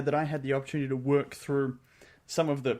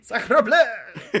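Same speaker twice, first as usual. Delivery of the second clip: loud, higher French exclamation; French accent; 8 semitones higher, 9 dB louder than usual. Pretend. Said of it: "I'm gonna make you do French".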